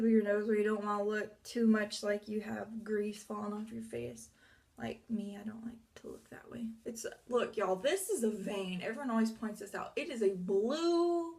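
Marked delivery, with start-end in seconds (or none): 4.8-6.7 whisper. Real, not acted. Whispered aside.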